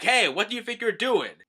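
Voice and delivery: indignant challenge. Pretend. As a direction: demand what he's doing. Brook confronts the attacker.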